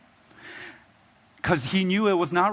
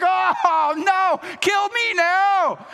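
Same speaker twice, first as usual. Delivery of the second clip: high, slow hammed-up shout of despair; despairing wail; 14 semitones higher, 3 dB louder, much slower than usual. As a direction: wail it out like a sulking prophet begging to die. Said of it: "And Jonah's like".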